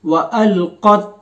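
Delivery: अलक़त slow, क़त closed with a bounce; slow tutor reading; qalqala ending. Shown as a demonstration. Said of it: "क़त पढ़ना है".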